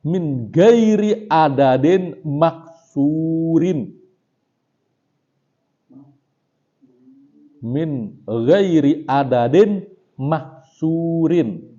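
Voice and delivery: slow, measured reading of Arabic text; kitab recitation. Acted. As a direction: intone each phrase slowly like reading a kitab aloud.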